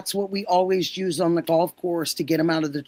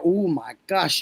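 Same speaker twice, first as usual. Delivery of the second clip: ordinary delivery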